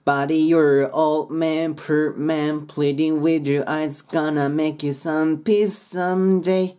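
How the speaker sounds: slow rhythmic English with linked words; lyric model. Shown as a demonstration. Teacher modelling the last verse, slow and rhythmic. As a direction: stretch "Buddy, you're old man" in the chant's rhythm. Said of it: "そして最後".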